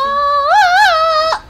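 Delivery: loud high sung wail; high sung shriek. Real, not acted.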